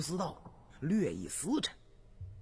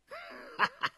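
short high-pitched laugh; hearty laugh; 3 dB quieter than usual. A narrator's reading. Prince laughs before reassuring his brother, brief laugh.

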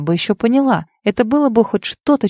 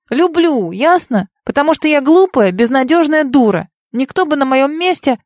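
angry crying; 6 semitones higher than usual. A narrator's reading. She confesses in tears.

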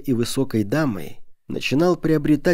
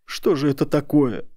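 higher, distressed voice; tearful complaint; 4 semitones higher than usual. A narrator's reading. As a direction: lament with a touch of tears. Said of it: "Старшая дама расплакалась".

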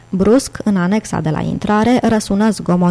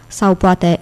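ordinary delivery, nothing marked

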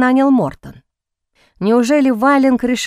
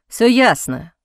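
through gritted teeth; slower than usual. A narrator's reading. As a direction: bite it out through clenched teeth. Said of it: "процедил он сквозь зубы".

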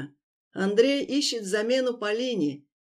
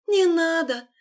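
begging; 10 semitones higher, 5 dB louder than usual. Acted. Begging for life.

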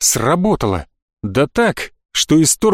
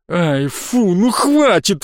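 high, pained begging; pleading; 4 semitones higher, much slower than usual. Acted. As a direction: beg him to stop, hurting. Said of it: "взмолился Истир".

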